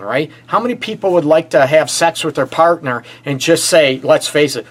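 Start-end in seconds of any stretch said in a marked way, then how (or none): none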